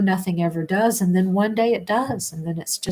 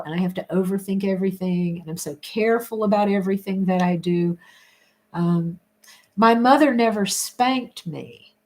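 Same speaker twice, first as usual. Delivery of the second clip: ordinary talk, nothing marked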